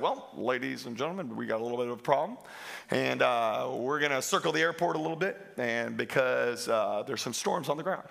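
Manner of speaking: low, soft airline pilot voice, easygoing; calm pilot announcement. Retold or imitated. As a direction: play it down like a pilot on the intercom. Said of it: "the pilot gets over the intercom. He's like".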